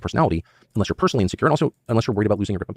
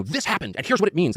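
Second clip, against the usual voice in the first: higher-pitched parody of confident politicians; mock politician; 8 semitones higher than usual. Guest parodies American politicians making claims, mocking high voice. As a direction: put on a high, self-certain announcing voice.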